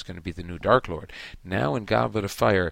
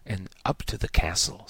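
silky; 2 semitones lower than usual. A narrator's reading. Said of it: "said Snape silkily".

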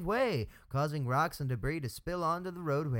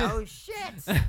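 surprised; 7 semitones higher, 4 dB louder than usual. Real, not acted. Crush reveal surprise.

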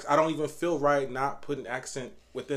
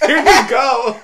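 laughing; 10 semitones higher, 14 dB louder, slower than usual. Real, not acted. Laughing at partner's joke.

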